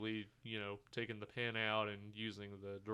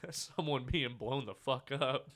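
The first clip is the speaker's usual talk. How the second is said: slower line delivered through laughter; amused, laughing retelling; 3 semitones higher, 5 dB louder, slower than usual. Real, not acted. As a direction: barely hold back laughter while quoting it. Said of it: "I just die".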